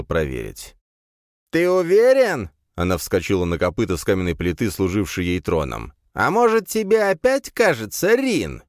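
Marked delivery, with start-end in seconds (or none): 1.5-2.5 high, slow creature matriarch voice; a suspicious beast mother. A narrator's reading. Mother creature questions son. 6.1-8.6 high, loud doubting creature mother; a scornful beast mother. A narrator's reading.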